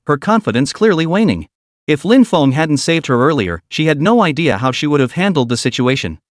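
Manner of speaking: ordinary delivery, nothing marked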